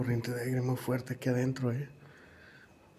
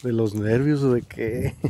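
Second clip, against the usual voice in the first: ordinary delivery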